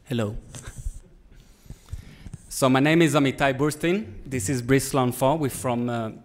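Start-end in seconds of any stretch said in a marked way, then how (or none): none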